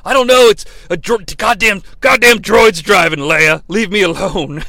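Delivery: loud, fast, high exasperated yell; truck driver dad yelling. Retold or imitated. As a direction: snap back like a fed-up dad driving. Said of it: "the truck driver dad who yelled at you earlier".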